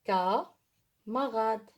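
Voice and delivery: "Camarade" very slow, ka-ma-rade pulled apart; slow corrected word. Shown as a demonstration. Teacher corrects herself and models the word slowly. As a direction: say "Camarade" again slowly and carefully.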